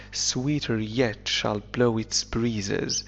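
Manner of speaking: ordinary talk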